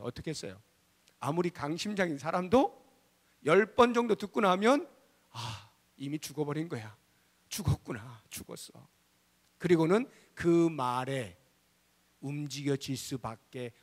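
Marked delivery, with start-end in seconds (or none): 5.3-8.9 low, soft, sinking voice; defeated resignation. Acted. Listener worn down by ten warnings, defeated and fading. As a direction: fade away as if all hope is gone.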